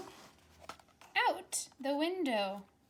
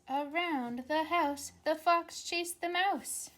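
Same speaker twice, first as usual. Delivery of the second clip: reading aloud; 4 semitones higher, 4 dB louder, slower than usual. Acted. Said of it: "it says".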